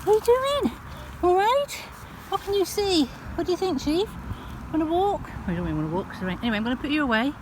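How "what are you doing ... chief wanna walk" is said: doting dog talk. Real, not acted. Owner chatting to her dog Chief on the walk, high doting baby talk. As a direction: coo at the dogs like babies.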